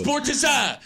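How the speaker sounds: "Sportiza" as three syllables, SPORT-iz-ah; mock-Irish pronunciation. Host shows how Ireland says it, "Sportiza" ending in an open "za". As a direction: put a joking Irish twist on "Sportiza". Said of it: "is how they say it in Ireland".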